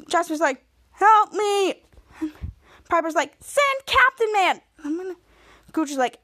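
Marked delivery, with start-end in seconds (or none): 0.9-1.8 higher, louder mock plea; cry for help. Dangling friend calls for help. 3.4-4.6 high, drawn-out demand; insistent demand. Retold or imitated. Pleading with hotline operator.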